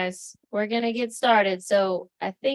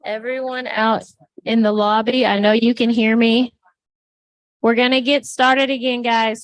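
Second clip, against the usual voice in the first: loud sharp call to the room; mom voice; 7 dB louder than usual. Real, not acted. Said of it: "My mom voice".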